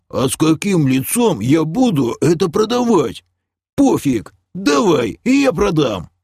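high, animated greedy muttering to oneself; agitated haggler weighing a forbidden deal. Acted. Eyeing the lilac scrolls, greedy and agitated.

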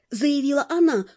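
usual speaking voice

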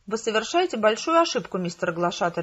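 ordinary delivery, nothing marked

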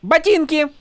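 high, imperious one-word demand; mock Horneater accent. A narrator's reading. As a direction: demand the boots grandly. Shallan demands the boots.

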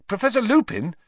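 high, loud call to break in; loud interruption. A narrator's reading. Harry interrupting his professor, loudly. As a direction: cut in loudly and urgently. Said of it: "Harry interrupted loudly".

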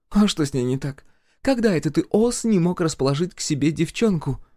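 high-pitched sister's needling; teasing older sister. Acted. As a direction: needle your brother about a girl, pitched high. (высокий насмешливый голос сестры)

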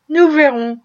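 plain reading voice

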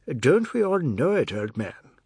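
ordinary delivery

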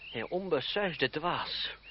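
very slow, drawn-out chiding; slow fond scolding. Acted. André to reckless Philippe, slow fond chide. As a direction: drag it out, shaking your head.

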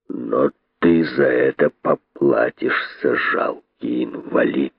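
low, slow threat; menacing. A narrator's reading. Python king threatening Kosoy, low slow menace. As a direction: sink low and drag out the warning. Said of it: "сказал он грозно".

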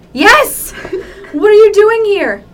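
high, loud and laughing through it; hammed-up excitement. Reunited characters shout in hammed-up laughing excitement. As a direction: overact the joyful reunion. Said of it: "reading the fic aloud".

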